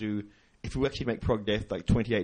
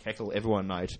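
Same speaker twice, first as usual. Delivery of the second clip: normal talking voice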